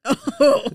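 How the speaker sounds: loud, high "oh" breaking into laughter; startled laugh. Real, not acted. Reacting to co-host's joke about death, startled laugh. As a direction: burst out laughing in surprise.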